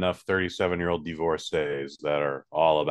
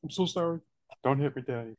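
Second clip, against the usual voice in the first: lightly voiced meek, higher little voice; cowering child; 6 semitones higher, 5 dB quieter than usual. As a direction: play-act a scolded kid pleading, softly. Plays scolded child to host.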